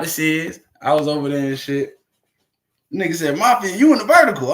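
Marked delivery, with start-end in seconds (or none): 3.4-4.5 raised, loud greeting to a friend; loud hype callout. Retold or imitated. Friend hyping the streamer on live, loud callout. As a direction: call it out high and loud.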